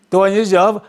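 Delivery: ordinary talk, nothing marked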